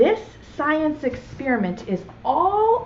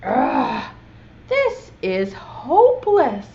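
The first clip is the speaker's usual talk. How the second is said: slow, groaning "this is hopeless"; a touch of storybook despair; exasperated; 2 semitones higher, 4 dB louder, slower than usual. Acted. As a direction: sigh it out, fed up. Boy gives up on the crane.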